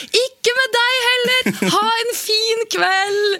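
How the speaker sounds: very high loud slow call; yelled cheerful reply far off; shouting back. Retold or imitated. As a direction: shout it back across the distance, friendly. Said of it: "jeg roper tilbake".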